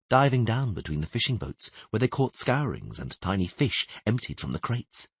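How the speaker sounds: ordinary delivery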